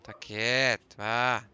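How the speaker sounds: loud firm command to stay put. Real, not acted. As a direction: shout it to calm the beast. Shouting at creature to stay.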